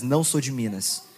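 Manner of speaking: normal talking voice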